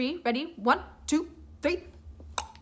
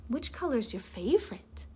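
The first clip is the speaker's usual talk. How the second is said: plain reading voice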